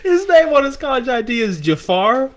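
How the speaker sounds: high laughing delivery. Real, not acted. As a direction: say it while cracking up. Laughing at funny name.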